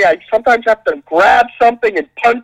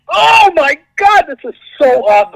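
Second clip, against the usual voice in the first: awed fan; 8 semitones higher than usual. Retold or imitated. Retelling the Doctor Strange fight, awestruck. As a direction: gasp in wonder then rush it out. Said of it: "you were just like".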